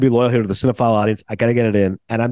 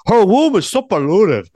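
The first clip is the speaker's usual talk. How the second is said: slow, higher, louder Cuban gangster growl; Tony Montana impression; 6 semitones higher, 4 dB louder, slower than usual. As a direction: ham up Scarface's disgust on womb. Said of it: "to quote Tony Montana in Scarface".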